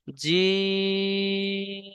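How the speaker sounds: জিম held out for three counts; long drawn jim. Shown as a demonstration. Teacher drawing out জিম for the students. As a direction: stretch the vowel of জিম long and steady. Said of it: "জিমটাকে এত টান দিলাম কেন".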